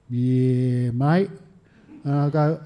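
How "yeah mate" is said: long drawn-out "yeah", rising "mate"; laconic veteran. Retold or imitated. Veteran answering a stranger's phone call, slow drawled reply. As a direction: draw it out like an old mate on the phone.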